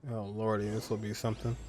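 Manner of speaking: usual speaking voice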